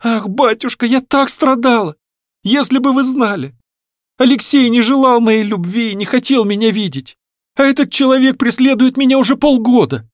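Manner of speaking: high-pitched, agitated lament; anguished young daughter. Acted. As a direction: pour out her suffering to her father. Voices Varya lamenting to father. (страдальчески, высоким девичьим голосом)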